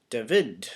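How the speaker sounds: David as "da-VID", second syllable stressed; David with a light first syllable, strong VID. Shown as a demonstration. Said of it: "the stressed syllable is this, uh, vid part".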